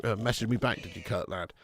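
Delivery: ordinary talk, nothing marked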